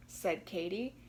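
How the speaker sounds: plain reading voice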